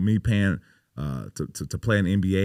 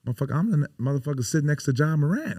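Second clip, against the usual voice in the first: raised, higher indignant protest; 8 semitones higher, 3 dB louder, faster than usual. Real, not acted. Player protests to host about the camp invite, indignant. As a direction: sound offended at the insult. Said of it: "indignant rant".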